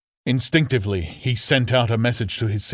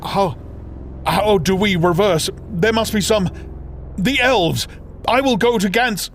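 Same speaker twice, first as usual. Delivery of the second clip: a brother frantic and disjointed, pitched high; 9 semitones higher than usual. Acted. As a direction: stumble from thought to thought in panic. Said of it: "frantic and disjointed".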